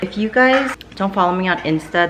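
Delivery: ordinary talk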